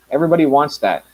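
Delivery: ordinary talk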